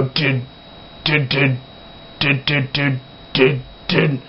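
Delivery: soft, staccato chugging with gaps; imitated breakdown chugs. Vlogger mocking repetitive breakdown riffs, soft imitated chugs. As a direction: mimic a sparse breakdown riff, quietly. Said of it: "all of a sudden they go".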